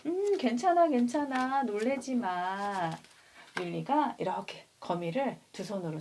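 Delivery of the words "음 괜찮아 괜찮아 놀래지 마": reassuring. Retold or imitated. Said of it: "어때요?".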